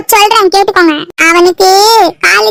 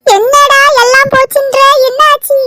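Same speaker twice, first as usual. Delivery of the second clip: higher, worried questioning; alarmed concern; 7 semitones higher than usual. Acted. Mother anxiously asking her crying son what happened.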